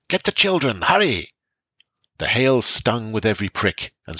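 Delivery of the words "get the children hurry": high urgent shout. A narrator's reading. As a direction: yell it out in a panic. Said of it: "shouted the Elder Seer".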